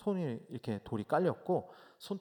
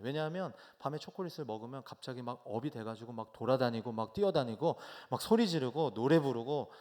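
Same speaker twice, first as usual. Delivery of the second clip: normal talking voice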